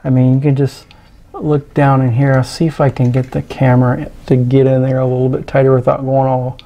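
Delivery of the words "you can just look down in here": usual speaking voice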